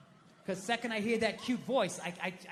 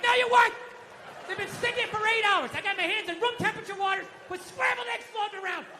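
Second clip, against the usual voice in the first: mock angry rant; 8 semitones higher, 5 dB louder than usual.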